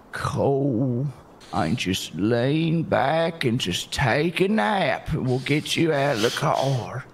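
slow, low, calming coax; soothing. Acted. The driver soothes the injured man with slow, low calm. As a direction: talk him down gently like he's fragile.

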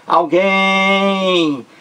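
"Alguém" with a very long, high "guém"; stretched last syllable. Shown as a demonstration. Teacher comparing it to armazém, drawn-out "guém" in "Alguém". Same stretched ending as example.

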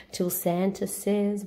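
plain reading voice

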